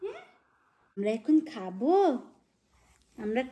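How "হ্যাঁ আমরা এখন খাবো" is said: normal talking voice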